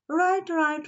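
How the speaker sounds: high, quick chatter; eager squirrel agreeing. Acted.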